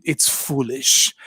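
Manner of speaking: normal talking voice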